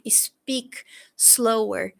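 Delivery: very slow, stretched words; slow demo. Shown as a demonstration. Teacher showing students how to ask, very slowly. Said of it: "Mais devagar, fala mais devagar".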